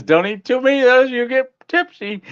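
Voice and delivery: high, quick teasing warning; lightly mimicking a friend's caution; imitating Darlene. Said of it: "Darlene says".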